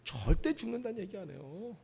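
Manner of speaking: ordinary talk, nothing marked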